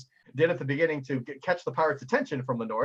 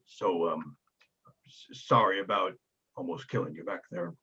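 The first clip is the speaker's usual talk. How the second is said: lower, halting, sheepish giant; embarrassed apology; 8 semitones lower, 4 dB quieter than usual. Acted. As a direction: apologize awkwardly. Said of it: "very embarrassed".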